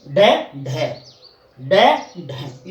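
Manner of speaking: डे then ढे, slow and clearly separated; slow teacher's drill of डे and ढे; pronunciation demo. Shown as a demonstration. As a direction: set डे against the aspirated ढे. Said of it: "इस तरह से इनके उच्चारण हैं".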